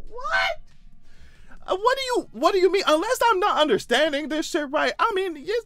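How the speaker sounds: shrieked high what, then high incredulous questions; shocked disbelief. Real, not acted. Vlogger reacting to the filing, high shocked squeal.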